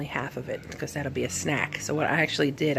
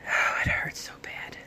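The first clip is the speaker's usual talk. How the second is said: pained whisper. Real, not acted. In pain after surgery.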